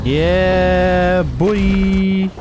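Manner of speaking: long, drawn-out celebratory call; victorious gamer's drawn-out cheer; triumphant cheer. Real, not acted. Celebrates a win in gameplay.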